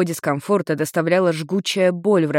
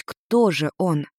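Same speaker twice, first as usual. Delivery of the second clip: ordinary delivery, nothing marked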